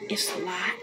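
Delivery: breathy whispered aside; whispering. Real, not acted. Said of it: "I gotta whisper".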